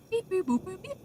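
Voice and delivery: high droid beeping noises; robot beeps. Pretend. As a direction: beep like a little robot. Mimicking droid scanner noises.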